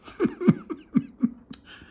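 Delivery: high bright burst of laughter; amused laugh. Real, not acted. Laughing at wife's full mouth.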